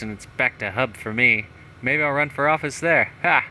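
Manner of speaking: boastful mayor in a put-on accent; hammed-up accent with loud mock "Ha!". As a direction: read the journal in a smug character accent. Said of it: "I don't know why I've got this accent".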